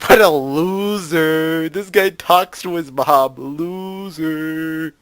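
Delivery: drawn-out, loud taunt; mocking jeer. Player ribbing the main character over mom, mocking jeer. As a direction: stretch it out like a playground heckle.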